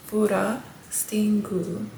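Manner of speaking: FUROSTINGU slowly, broken into parts; slow demonstration. Shown as a demonstration. Host teaching Japanese word for frosting, furostingu said slowly. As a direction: sound out furostingu slowly, pausing before stingu. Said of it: "Let's say it faster".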